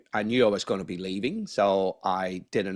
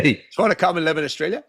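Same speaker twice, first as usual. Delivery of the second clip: high, louder, quick acted invitation; eager partner's invite; 8 semitones higher, 6 dB louder, faster than usual. Retelling how his Aussie partner asked him to move. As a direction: toss the question up high and fast, like she's keen.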